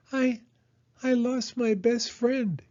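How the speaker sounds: very high, slow little whimper; falter, then whimper it out in a small creature's voice. Acted. Hedgehog telling Mole of a lost friend, whimpering. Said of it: "she whimpered".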